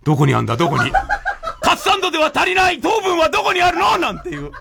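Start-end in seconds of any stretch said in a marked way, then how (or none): 1.6-4.0 hunt for it in mock panic; mock frantic search.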